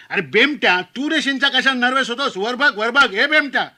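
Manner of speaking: high, loud teasing shout; shouting uncle. Retold or imitated. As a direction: call it across the dinner row at the nervous groom. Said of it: "पुन्हा ओरडला".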